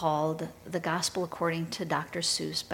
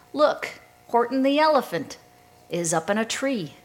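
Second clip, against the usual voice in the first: louder, higher mock shout, easing off; gleeful shout; 5 semitones higher, 6 dB louder than usual. A narrator's reading. Animal friends mocking Horton in his tree, gleeful storybook shout. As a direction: call it out with storybook glee, strongest at the start. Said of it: "they shouted with glee".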